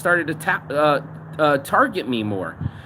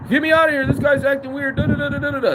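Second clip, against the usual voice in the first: mock panic; 9 semitones higher, faster than usual. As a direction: imitate a cellmate freaking out, pitched way up. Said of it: "first time in jail. Starts freaking out".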